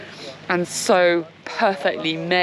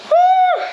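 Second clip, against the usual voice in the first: loud high whoop; excited whoop; 6 dB louder than usual. Real, not acted. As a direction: let out a happy woo. Celebrating after cold dunk.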